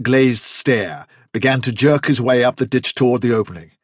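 plain reading voice

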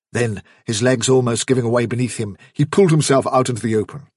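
plain reading voice

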